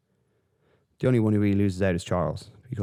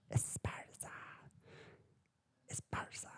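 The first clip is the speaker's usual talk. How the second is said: breathy stage whisper of the name; dramatic whisper; 19 dB quieter than usual. Acted. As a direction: hiss the name as if it's a secret.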